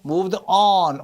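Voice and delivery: "on" long and punched; stress on "on". Shown as a demonstration. Teacher echoes the recording, "on" strongly stressed. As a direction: land hard on "on". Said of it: "on에 강세가 들어가죠".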